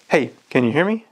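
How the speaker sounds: normal talking voice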